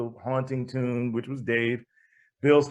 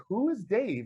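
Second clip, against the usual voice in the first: high-pitched retold bewilderment; acted baffled aside, pitched high; puzzled question; 8 semitones higher, 4 dB quieter than usual. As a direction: wonder it aloud in a high voice. Retelling puzzlement over the name.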